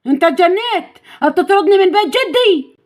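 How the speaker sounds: high-pitched outraged protest; acted villain's affronted retort; indignant. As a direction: object indignantly to being thrown out. Villain protests being thrown out.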